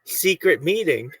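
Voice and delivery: ordinary talk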